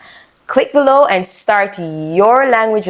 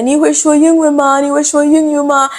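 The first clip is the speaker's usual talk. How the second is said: asking in Chinese; 7 semitones higher, faster than usual. Retold or imitated. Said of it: "and I said".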